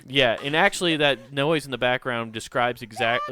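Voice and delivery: usual speaking voice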